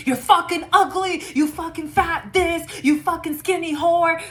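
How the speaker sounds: high, loud hammed-up yelling; mock screaming insults. Reader voicing a jealous enemy attacking beauty, mock yelling. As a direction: shriek the insults at someone's looks.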